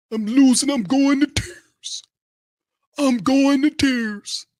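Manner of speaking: high, slow fake sobbing falsetto; hammed-up blubbering voice; mock crying. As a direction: pretend to be on the verge of tears.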